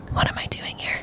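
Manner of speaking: hushed, voiceless aside to oneself; whispered self-doubt. Retold or imitated. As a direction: whisper it like a panicked private thought. Retold private doubt among seasoned travelers.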